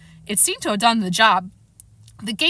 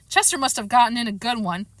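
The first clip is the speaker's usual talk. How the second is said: usual speaking voice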